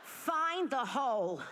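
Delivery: lightly voiced put-down granny; nagging grandmother. Grandmother puts her husband down, lightly mocked nagging. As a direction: imitate grandma scolding, slow and drawn out. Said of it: "she would just put him down".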